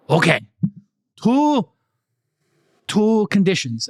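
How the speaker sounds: put-on Korean accent. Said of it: "I can do Korean".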